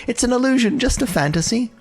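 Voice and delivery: lilting high voice soothing Lady Fuxuan; sing-song reassurance. Acted. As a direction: coo it to calm her down.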